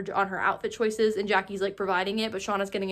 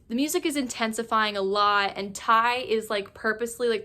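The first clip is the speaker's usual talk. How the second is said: usual speaking voice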